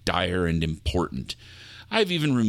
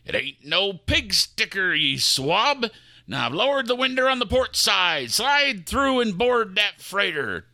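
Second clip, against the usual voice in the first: put-on higher pirate accent, gruff orders; 9 semitones higher than usual.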